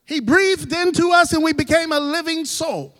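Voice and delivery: full-voiced proclamation of the living soul; proclaiming. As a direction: ring it out to the congregation. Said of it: "repeated line, proclaimed then soft".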